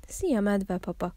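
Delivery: plain reading voice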